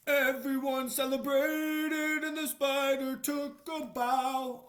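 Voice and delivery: put-on slow, loud read-aloud for children; storybook reading. A narrator's reading. Reading the book page aloud to children, slow and loud. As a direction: read it out big and slow for little listeners.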